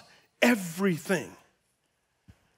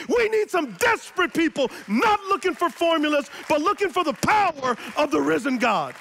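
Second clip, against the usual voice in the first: full-force preaching; 8 semitones higher than usual. Acted. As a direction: preach with conviction.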